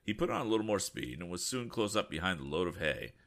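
ordinary delivery

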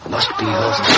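ordinary delivery

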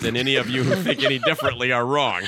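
ordinary talk

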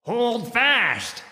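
high, loud bellowed order; bellowing. Acted. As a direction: bellow it over the storm. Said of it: "Tudor bellowed".

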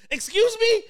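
high, loud disbelieving excuse me; incredulous. Retold or imitated. Told there's no band at the desk, high loud disbelief. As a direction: shoot it up in shock. Stunned reply to staff, retold.

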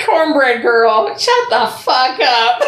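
laughing through the words, slow; slow giggling put-down. Real, not acted. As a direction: crack up while telling her off. Laughing at the commenter.

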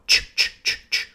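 voiceless, rhythmic tch-tch-tch-tch; steam train chuffing. Shown as a demonstration. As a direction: imitate a steam train with repeated CH. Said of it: "It's like a steam train".

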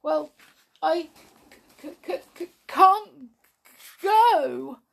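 high, sobbing stutter swelling into a loud wail; tearful stammer. A narrator's reading. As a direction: stammer it out through acted sobs. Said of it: "wept two such large tears".

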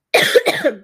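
loud cough; hacking throat cough; chesty cough. Real, not acted. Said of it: "sorry about that".

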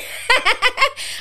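amused high-pitched laugh. Real, not acted. Vlogger laughing at her own accent, high laugh. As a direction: laugh at your own bit.

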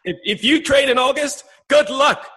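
high, put-on voice mimicking cohost; squeaky send-up of his cohost's warning. Mimicking his cohost to his face, high put-on voice. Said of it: "I'm gonna try and mimic you".

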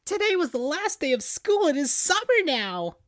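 high cartoon fox voice; Tails voice. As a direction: play a high-pitched young sidekick. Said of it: "said Tails".

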